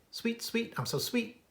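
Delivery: high, sweet little bird voice; high piping birdsong; sweet warbler song. Acted. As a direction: sing it up high like a tiny warbler. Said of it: "sings sweetly".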